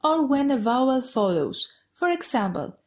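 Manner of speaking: usual speaking voice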